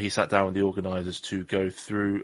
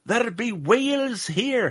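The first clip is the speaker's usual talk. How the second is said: high-pitched, drawn-out bad Scottish; hammed-up Scottish accent, loud and high; terrible Scottish accent; 13 semitones higher, 4 dB louder, slower than usual. Host announcing whale sightings to the captain, bad Scottish accent. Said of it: "that was a terrible Scottish accent".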